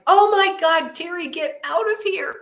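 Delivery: high panicked burst that drops away; panicked self-talk. Retold or imitated. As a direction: urge yourself to flee in alarm. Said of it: "I'm like".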